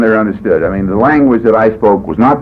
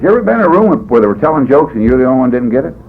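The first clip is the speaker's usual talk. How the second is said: usual speaking voice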